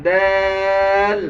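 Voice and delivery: দাল held long and even without a push; unpushed dal. Shown as a demonstration. Teacher drilling the letter, long smooth দাল. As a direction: stretch দাল steadily, no jolt. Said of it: "এবার ধাক্কা দেওয়া যাবে না".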